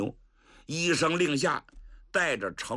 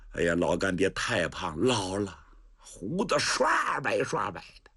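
ordinary delivery

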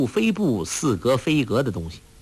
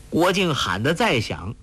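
ordinary delivery, nothing marked

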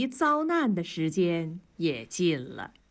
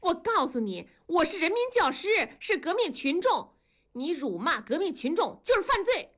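much higher, shrill with outrage; furious confrontation; 10 semitones higher than usual. A narrator's reading. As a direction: jab every word at him like an accusation. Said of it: "扑过去，指着接待员的鼻子问". (怒气冲冲地高声质问)

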